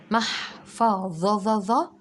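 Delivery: محفظة drawn out very slowly, each syllable held apart. Shown as a demonstration. Teacher slowly spells out محفظة for the children. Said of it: "بشويش وركز".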